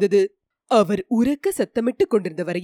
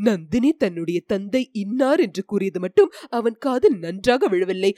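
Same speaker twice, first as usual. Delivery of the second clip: ordinary delivery, nothing marked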